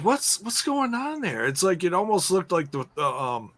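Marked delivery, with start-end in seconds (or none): 0.0-1.5 high-pitched bewildered reaction; playfully incredulous, pitched high; mock bafflement. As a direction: act out being baffled, voice jumping up. Acting out own baffled reaction.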